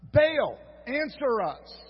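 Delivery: slow, high, loud cry to a god; shouted plea. Acted. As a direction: call out to Baal, drawn out and desperate. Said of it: "they shouted".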